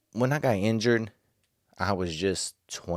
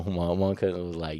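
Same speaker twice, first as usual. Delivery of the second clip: ordinary talk, nothing marked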